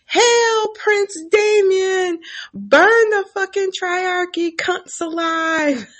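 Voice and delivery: high, slow hammed-up cheer; nailed soldier cheering the prince in a high slow voice; mock soldier yell. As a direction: drag out the hail in a high put-on voice. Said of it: "soldier who was nailed to the stump yell".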